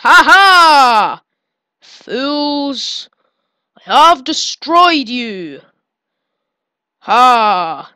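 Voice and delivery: loud theatrical villain crow; hammed-up villain gloat. Player gloats over defeated cells, hammed-up villain triumph.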